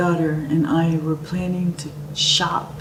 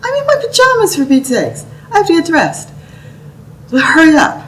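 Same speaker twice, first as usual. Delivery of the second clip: much higher and louder, flustered mother in pajamas; flustered protest; 8 semitones higher, 7 dB louder, faster than usual. Retold or imitated. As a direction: squeal it out, high and loud, caught unready. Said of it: "And I going".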